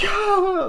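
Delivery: very high, loud mock shriek; hammed-up horror scream. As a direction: shriek like a slasher victim. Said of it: "just screaming and shit".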